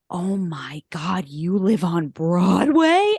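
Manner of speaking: slow, drawn-out mock astonishment; hammed-up awe, rising on the last word; retold awestruck amazement. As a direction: imitate someone gobsmacked, building to a stretched question. Retold amazed phone agent's reaction.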